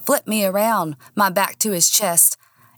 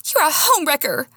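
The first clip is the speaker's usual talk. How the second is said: high-pitched, moderately raised yell; screamed accusation; 9 semitones higher than usual. A narrator's reading. Woman screaming at her rival, angry. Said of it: "I screamed in her face".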